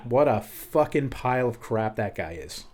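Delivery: usual speaking voice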